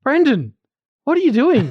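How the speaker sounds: high-pitched mock scolding; exasperated call-out. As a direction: call out the window at a noisy singer. Calls from window to noisy singer.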